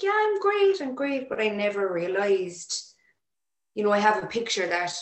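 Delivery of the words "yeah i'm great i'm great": bright, much higher and louder forced cheer; fake cheerful. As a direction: brush it off with a fake smile. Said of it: "And I was like".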